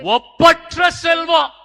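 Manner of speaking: slow, high, loud shout; climactic proclamation. Real, not acted. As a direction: punch out each syllable at full voice. (உச்சக் குரலில் அழுத்தமான முழக்கம்)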